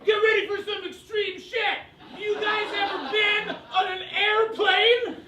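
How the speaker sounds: loud, high, hammed-up hack comic shout; hack comedian. Mocking hack comedians who hype a crowd, high shouted. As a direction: bellow it like a cheesy comic hyping the crowd. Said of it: "I do fucking hate comedians that go up here".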